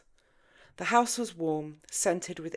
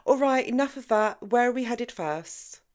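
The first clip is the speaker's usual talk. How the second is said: higher, louder cut-in; raised, brisk interruption; interjecting; 8 semitones higher, 8 dB louder than usual. A narrator's reading. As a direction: break up the tension brightly. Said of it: "Jenny interjected".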